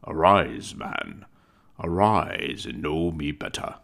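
a giant spirit calling Scrooge to rise; booming spirit. Acted. Ghost of Christmas Present booms.

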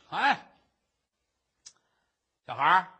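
loud call across the river; a scoundrel loudly hailing a little girl. Acted. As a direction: hail the girl loudly, half sly. Villain calling out to the girl.

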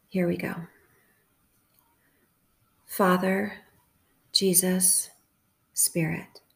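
unhurried prayer in short, separated phrases; calm, measured meditative praying; guided prayer meditation. Acted. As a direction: leave long silences for listeners to repeat each phrase.

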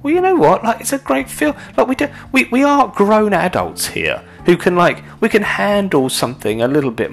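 high, loud indignant comeback; comic pushing back at overcautious critics; indignant outburst. Real, not acted. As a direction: push back hard on the critics. Comic rant retort.

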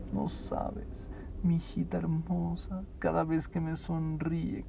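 hushed adoring confession, slow; tender love. Shown as a demonstration. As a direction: melt over a beloved daughter. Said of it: "Lo estoy exagerando".

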